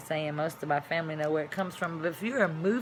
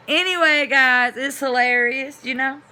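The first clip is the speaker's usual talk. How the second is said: excited; 6 semitones higher, 9 dB louder than usual. Real, not acted. Telling viewers the word game is funny, delighted. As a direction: gush about how funny it is. Amused after demo.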